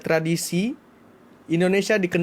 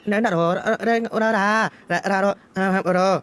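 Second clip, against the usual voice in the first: sing-song Junjung imitation; 3 semitones higher than usual.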